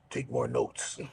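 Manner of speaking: low, curt mock boss voice; a hint of a gruff boss handing back homework; imitating Vince. Vince mocked low, checking the notebook. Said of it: "he looked over it and he goes".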